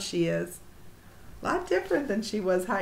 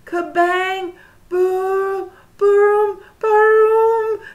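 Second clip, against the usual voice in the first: booming louder and higher each time; loud thunder booms; 10 semitones higher, 11 dB louder, much slower than usual. A narrator's reading. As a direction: shout the thunder. Thunder sound voiced in story.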